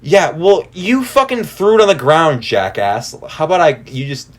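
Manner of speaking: worked-up rant, stumbling at the end. Retold or imitated. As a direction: snap back in exasperation as you retell it. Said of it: "I'm getting worked up".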